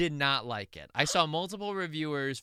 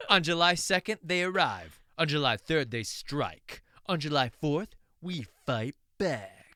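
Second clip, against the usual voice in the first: dramatic trailer read; 4 dB louder than usual. Acted. Reading movie tagline dramatically.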